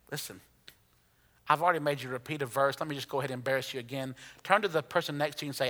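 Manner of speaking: fast, soft joking aside; rushed aside. Real, not acted. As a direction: hurry through the setup. Quick aside to congregation.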